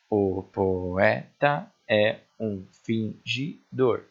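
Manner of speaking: slow, evenly separated syllables. Shown as a demonstration. Teacher repeats the verse to count nine syllables, syllable by syllable. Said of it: "Nove sílabas gramaticais".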